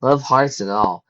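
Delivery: ordinary delivery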